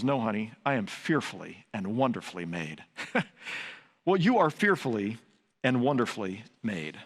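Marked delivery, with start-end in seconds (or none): 0.0-2.8 lower, softer, deadpan comeback; smug deadpan reply. Retold or imitated. Husband answers his wife with a smug, low deadpan. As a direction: drop it low and smug for the punchline. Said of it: "And my response was".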